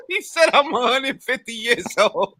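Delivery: words squeezed out high through laughter; laughing while talking. Real, not acted. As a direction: still laughing as you retell it.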